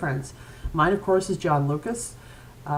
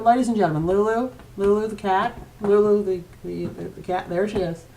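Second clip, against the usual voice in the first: usual speaking voice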